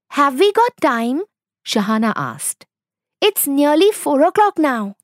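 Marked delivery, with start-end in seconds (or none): 0.1-1.2 put-on higher-pitched girl's voice; lightly acted child, raised pitch; young daughter. A narrator's reading. Young daughter questioning her mother. 3.2-5.0 put-on higher-pitched girl's voice; lightly acted child, raised pitch; young daughter. A narrator's reading. Young daughter speaking to her mother.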